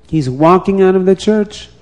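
plain reading voice

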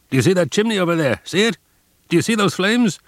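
high, loud and fast, jabbing at the chimney; increasingly brutal. A narrator's reading. Guard points the prisoners to the burning chimney, brutal. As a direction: taunt them with the flames. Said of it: "His tone of voice became increasingly brutal".

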